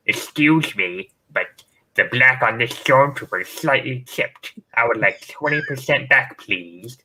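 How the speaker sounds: mock a picky collector demanding a refund; fussy collector voice. Collector to seller, a prissy slow complaint. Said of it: "these guys, like".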